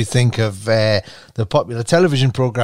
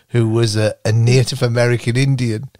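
ordinary delivery

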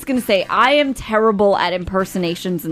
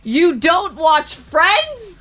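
imitating stunned listeners, drawn out slowly; mock outraged listeners; 7 semitones higher, much slower than usual. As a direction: stretch it out like a shocked fan. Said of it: "thousands of people ... screaming into their radios".

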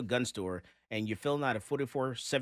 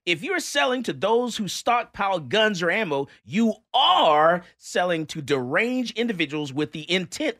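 raised, punchy mock-indignant oration; theatrical reading of an angry letter; dramatic letter reading; 6 semitones higher, 6 dB louder than usual. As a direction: declaim the hate mail with heavy stress on key words. Reads hostile letter aloud theatrically.

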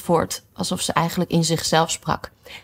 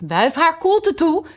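high, slow, anxious order; worried; 12 semitones higher, 3 dB louder, slower than usual. Acted. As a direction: fuss over the girl. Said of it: "bezorgd tussen beiden". (bezorgd en hoog)

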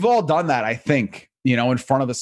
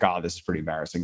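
much softer, fast, muttered to himself; embarrassed aside; 7 semitones lower, 8 dB quieter, faster than usual. Retold or imitated. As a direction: mumble it quickly under your breath. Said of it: "I was like".

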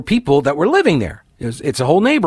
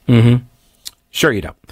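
sarcastic; 4 semitones lower than usual. Real, not acted. Sarcastic retort.

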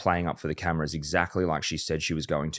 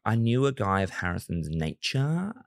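annoying upward inflection, slowed down; mock her with an exaggerated rise at the end; slow quote swooping up on the last word; Bronte. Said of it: "You did Bronte voice really well there".

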